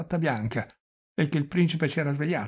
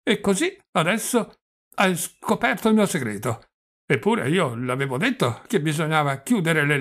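put-on loud, raised old man's voice with a stumble; doddering old prince, agitated; 5 semitones higher, 6 dB louder than usual. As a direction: fuss insistently like a flustered old prince. (vecchio principe agitato che inciampa sulle parole)

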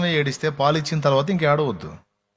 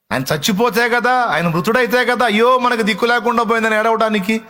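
mock lament; 7 semitones higher, 3 dB louder than usual. Preacher mimics needless wailing over death.

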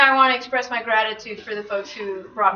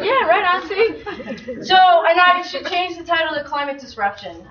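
ordinary talk, nothing marked

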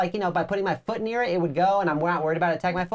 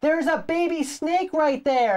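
high, slow called-out warning; wife's mock called warning, slow; calling warning; 9 semitones higher, slower than usual. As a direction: shout a warning from behind. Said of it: "she's like".

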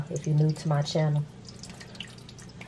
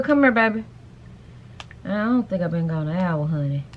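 usual speaking voice

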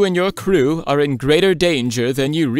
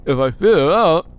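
muffled through dry cookies; mouth full; 5 semitones higher than usual. Acted. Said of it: "don't talk with your mouth full".

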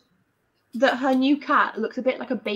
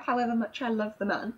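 ordinary talk, nothing marked

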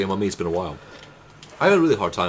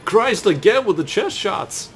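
higher, louder, slow complaint; exasperated outcry; 5 dB louder, slower than usual. Real, not acted. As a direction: groan at the game in disbelief. Exasperated at the game.

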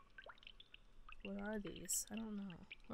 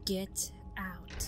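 menacing whisper; 2 semitones lower than usual. Acted. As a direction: breathe the words like a ghost. Reads threatening wall text.

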